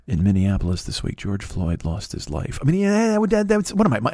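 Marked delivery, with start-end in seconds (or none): 0.1-2.6 low, grave broadcaster's delivery; somber show opening. Shown as a demonstration. Broadcaster mocking how he could open the show, low and somber. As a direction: drop low and solemn, as a mock special episode. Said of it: "if I started to talk like this".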